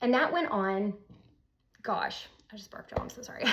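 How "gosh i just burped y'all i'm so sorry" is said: hushed, softer apology; embarrassed whisper. Real, not acted. Apologizing to viewers for a burp, in a whisper. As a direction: whisper it off to the side, sheepish.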